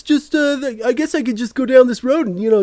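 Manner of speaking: high-pitched put-on thief musing aloud; thief's voice. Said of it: "So he's like".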